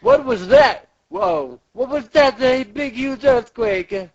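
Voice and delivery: high, startled voice; high-pitched cartoon astonishment; mock alarm. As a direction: cry out as if the ground shook. Acted alarmed character in factory.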